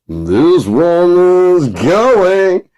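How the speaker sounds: high, loud, very slow crooning; lounge singer. Retold or imitated. Showing the sheen, very slow loud lounge crooning. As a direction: stretch it out with showbiz sheen.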